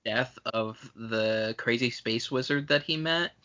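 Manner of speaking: ordinary delivery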